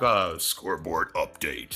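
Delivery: low, slow sportscaster. Acted. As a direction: announce it like a sports anchor. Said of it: "Is that your E S P N imitation?".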